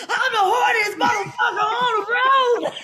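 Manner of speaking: high laughing shout, full commitment; committed yell. Retold or imitated. Stuntwoman reenacting her committed take, laughing high shout. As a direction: belt it out and commit. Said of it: "Commit to it".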